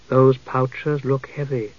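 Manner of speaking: soft, slow elf lord; smooth elf voice. A narrator's reading. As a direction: purr it softly and slowly. Dalamar speaks slowly.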